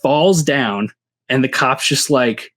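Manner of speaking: usual speaking voice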